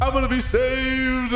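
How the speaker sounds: high, drawn-out gleeful cry; overjoyed. Pretend. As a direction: stretch it high with hammed-up relief. Cops arrive, rejoicing at rescue.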